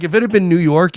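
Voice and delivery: ordinary talk, nothing marked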